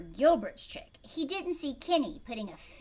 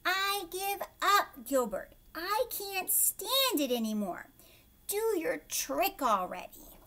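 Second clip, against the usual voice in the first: raised, exasperated outburst; a fed-up cry of giving up, a little higher; mild exasperated shout, higher; 7 semitones higher, 4 dB louder than usual. A narrator's reading. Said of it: "Louis finally shouted".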